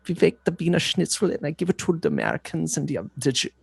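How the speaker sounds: put-on goofy German hot dog man accent; light, self-aware fake German accent. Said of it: "that's the one I can, uh, semi do".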